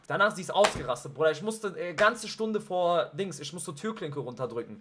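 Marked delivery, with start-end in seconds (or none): none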